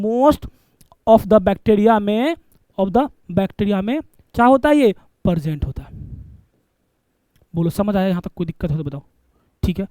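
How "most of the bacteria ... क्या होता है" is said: most of the bacteria dragged out word by word, rising; teacher slowing right down to repeat most of the bacteria; slow dictation. Shown as a demonstration. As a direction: stretch each word so students can write it down.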